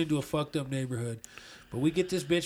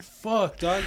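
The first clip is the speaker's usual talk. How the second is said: sudden higher, louder panicked swear; alarmed curse; 5 semitones higher, 4 dB louder than usual. Retold or imitated. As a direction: blurt it out in shock. Panicked reaction to gun threat.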